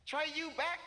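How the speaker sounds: high-pitched mock kid's call; eager calling out. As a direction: call out eagerly, imitating a little girl. Retold girl calling to her brother.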